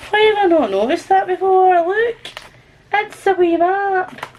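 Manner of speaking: high, excited surprise; delighted discovery. Real, not acted. Reader spots an unnoticed map in the book, delighted. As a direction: marvel aloud at a find.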